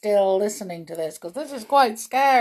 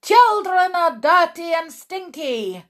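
high, loud witch shriek; witch scream; 10 semitones higher, 7 dB louder than usual. Acted. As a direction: scream it in disgust. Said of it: "screamed the Grand High Witch".

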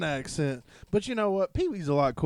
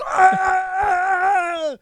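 high, held scream; loud playhouse shriek; secret-word scream; 5 dB louder than usual. Acted. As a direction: scream on one very high note.

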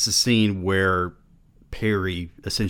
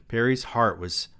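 usual speaking voice

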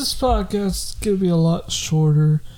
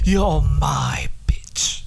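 slow, whispered gloat; mock villain whisper; 4 semitones lower, slower than usual. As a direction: gloat slowly under your breath.

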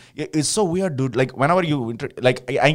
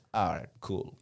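very soft, low dismissal; mock disinterest, barely voiced; unimpressed; 5 semitones lower, 13 dB quieter than usual. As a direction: brush him off quietly. Girl uninterested in his name.

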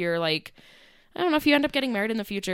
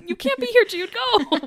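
very high, louder amused scolding; mock shooing; 3 dB louder than usual. Bride shooing a boy from the vows, mock urgent. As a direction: shoo a child away, half laughing.

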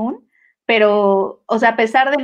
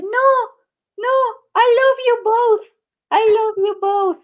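high, drawn-out mock wail; hammed-up pleading movie queen, pitched way up; imitación de la reina Anne; 12 semitones higher, slower than usual. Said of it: "la reina Anne ... llega y le dice". (imitando a una reina melodramática, agudo y alargado)

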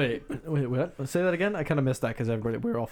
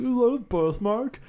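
higher, slow dopey question; mocking coworker; 11 semitones higher, 4 dB louder, slower than usual. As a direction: play the guy as clueless. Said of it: "he's like".

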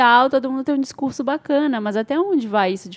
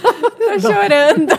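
crying laughing; 7 semitones higher, 4 dB louder, much slower than usual. Real, not acted.

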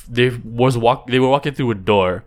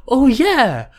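chirpy cartoon host; 8 semitones higher than usual. A kids' show host quizzing the sidekick, high and chirpy. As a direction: bounce it like a kids' TV presenter.

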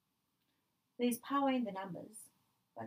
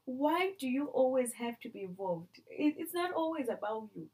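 exasperated scolding; 5 semitones higher, 6 dB louder than usual. Real, not acted.

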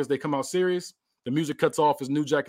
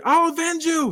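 high heroic cartoon vow; 3 dB louder, slower than usual. Wrestler vowing revenge for his partner, high heroic voice. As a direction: declare it like a hero. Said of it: "we see Xavier say".

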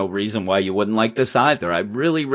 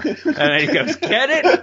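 laughing at own joke; 13 semitones higher than usual. Retold or imitated. Retelling Deadpool's pun.

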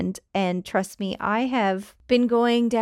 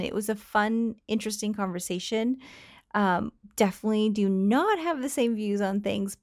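ordinary talk

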